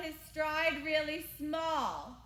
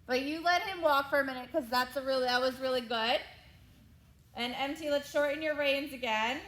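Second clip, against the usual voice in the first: louder, brisk arena calls; brisk projected riding commands; 7 dB louder than usual. Real, not acted. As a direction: project commands to a rider across the ring. Coach calling across the arena.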